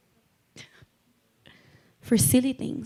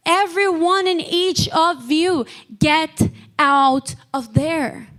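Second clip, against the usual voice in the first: higher, commanding preacher's call; forceful preaching; 6 semitones higher than usual. Real, not acted. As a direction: call each listener out with urgency. Preaching commands to named listeners.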